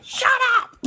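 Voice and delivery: sudden high shriek of mock outrage; high squealing "shut up" at a friend who is right; playful shout. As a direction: squeal it at him, half laughing.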